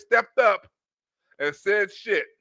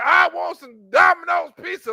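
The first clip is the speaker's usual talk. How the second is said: higher, shouted whiny order; mock shouted demand; 6 semitones higher, 4 dB louder than usual. Imitating the train man yelling for pizza, higher and louder. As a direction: holler it like a spoiled customer.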